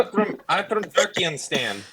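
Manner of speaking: put-on Borat-style accent. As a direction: ham it up like a fake Borat. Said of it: "You went Borat on him".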